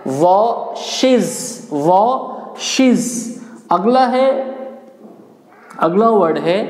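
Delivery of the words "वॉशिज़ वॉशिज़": वॉशेस very slowly as "waa... shiz"; correct pronunciation. Shown as a demonstration. Teacher drilling the new ending, correct pronunciation वॉशेस as slow "waa-shiz". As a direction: pull वॉशेस apart, final buzzing z. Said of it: "ये आपका जेड बनेगा".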